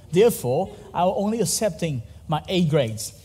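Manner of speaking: usual speaking voice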